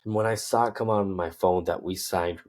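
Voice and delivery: ordinary talk, nothing marked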